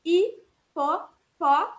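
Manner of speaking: Hipopó stretched very slowly, silent H, starts with an I sound; Hipopó broken down slowly, stress landing on pó; very slow teacher breakdown of Hipopó; slow syllables. Shown as a demonstration. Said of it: "é como se a palavra começasse com I".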